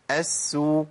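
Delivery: أسو held s then rounded u; doubled sin with damma. Shown as a demonstration. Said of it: "التشديد بالضم".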